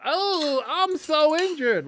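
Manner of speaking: very high, drawn-out fake whine; mocking high-pitched wail; mock injured player. Mocks player faking an injury.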